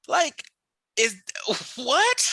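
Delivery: high, incredulous laughing outburst; baffled disbelief. Retold or imitated. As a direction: let the pitch jump up, lost for words. Baffled at the backhanded racist remark.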